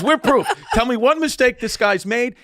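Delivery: high, insistent mock demand; heated challenge. Funeral director challenging mourners about Trump, heated. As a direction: act out a worked-up man demanding an answer.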